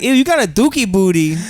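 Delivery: high, lightly imitated woman recoiling; mock disgust. Riffing as the grossed-out woman, high mock disgust.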